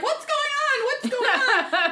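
high, laughing confusion; bewildered and alarmed; confused alarm. Retold or imitated. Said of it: "I'm like".

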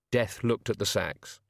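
plain reading voice

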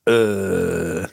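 the single Hull vowel, a drawn-out flat "uh"; Hull accent. Shown as a demonstration. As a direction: show off the one vowel of Hull. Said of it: "That's the Hull accent".

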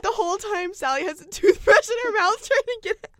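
loud high laughing telling. Real, not acted. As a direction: laugh your way through it. Laughing at absurd scene.

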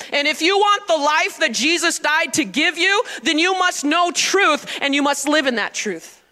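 ordinary talk

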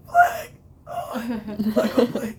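high, strained, cracking yell; voice given out. Shown as a demonstration. Band captain after ten cheers a game, voice failing. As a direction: try to yell with a worn-out voice. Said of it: "it gets pretty bad at the end".

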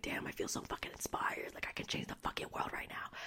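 awed whisper; hushed, starstruck whisper; inspired whisper. Retold or imitated. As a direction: whisper it like a secret vow. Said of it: "And you're like".

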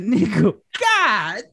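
very high, slow, laughing mock shout; laughing outrage. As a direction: shout it up high while cracking up. Retold outrage over cold chicken.

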